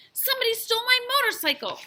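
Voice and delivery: high, fast, loud outcry; frantic high-pitched shouting; shouted accusation. A narrator's reading. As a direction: yell it like a boy who's been robbed. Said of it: "shouted the boy".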